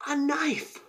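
loud, high-pitched "knife" in mock alarm; shocked exclamation. Telling how friend grabbed a weapon, shocked exclamation. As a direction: cry out KNIFE, high and incredulous.

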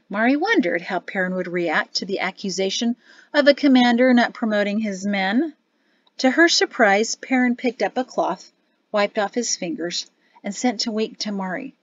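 usual speaking voice

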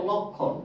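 লক্ষণ said as lok-khon, no m; silent ম. Shown as a demonstration. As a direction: double the k sound in লক্ষণ without any m. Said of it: "তখন তার উচ্চারণ হবে না".

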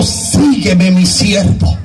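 low, measured divine summons; God. Retold or imitated. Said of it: "oí la voz de Dios que me dijo". (voz de Dios, grave y solemne)

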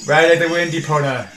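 loud, high mock-heroic cry; playful rallying shout; heroic call. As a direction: urge the horse on theatrically. Rallying the horse in the game.

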